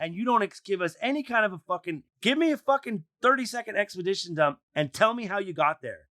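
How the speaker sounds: high-pitched exasperated demand; pissed-off rant. Real, not acted. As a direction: demand an explanation, fed up. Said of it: "I'm fucking pissed".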